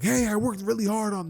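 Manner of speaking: high-pitched, indignant objection; offended protest. Acted. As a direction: whine defensively at unimpressed classmates. Boy protests at classmates' reaction.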